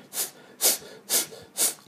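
short bursts of air between tongue and teeth; English th sound demonstrated in puffs; voiceless th hiss. Shown as a demonstration. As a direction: let air leak softly, no voice. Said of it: "空気が漏れる音を再現".

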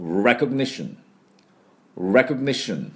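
recognition in careful English, stressed REC and falling end; repeat-after-me model. Shown as a demonstration. Teacher leading learners to repeat, recognition in English pronunciation. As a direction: model recognition with a weak middle o. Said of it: "私の後について発音いきましょ".

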